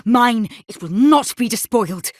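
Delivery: high, agitated plea against a spirit; distraught defiance. Acted. Tormented soul telling a spirit to go away, distraught. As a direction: fight off a vision in rising panic.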